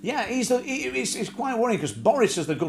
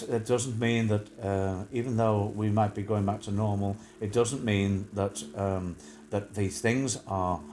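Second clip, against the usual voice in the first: droning official impression; 8 semitones lower, 4 dB quieter than usual. Host mimics a doom-and-gloom official, low droning impression. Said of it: "and that's how he talks".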